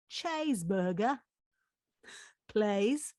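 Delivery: light Australian accent, E's to A's. Shown as a demonstration. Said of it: "an Australian accent".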